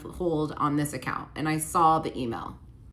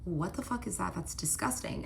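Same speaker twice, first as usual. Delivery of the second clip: retold disgusted aside; 8 dB quieter than usual. Girlfriend seeing spit land on a friend's shoulder, hushed disgust. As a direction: say it softly to yourself, appalled.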